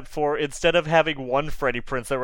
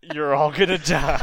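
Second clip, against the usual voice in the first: slow, lightly put-on newsreader voice; mock radio newsreader. Podcaster spoofing calm radio news about a burning world, mock soothing. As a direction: draw it out like a mock public-radio host. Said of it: "in a very low voice".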